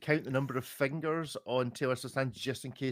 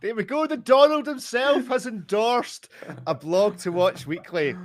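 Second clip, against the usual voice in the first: excited; 10 semitones higher, 7 dB louder than usual. Real, not acted. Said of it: "excited reveal".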